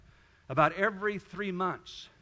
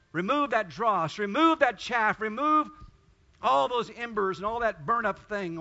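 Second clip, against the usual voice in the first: fervent prayer; 3 semitones higher, 6 dB louder than usual. Acted. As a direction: cry out to God for the whole room.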